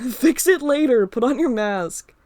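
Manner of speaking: loud, laughing call back, slowing at the end; running ahead, yelling back to friend. Acted. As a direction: shout back through laughter. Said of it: "I yell back, laughing more".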